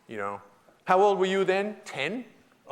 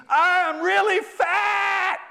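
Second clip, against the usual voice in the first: high, slow, loud yelling down; a hammed-up yell from a ledge; retold yell; 14 semitones higher, 5 dB louder, much slower than usual. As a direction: shout it down from the window. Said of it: "she yells down to me".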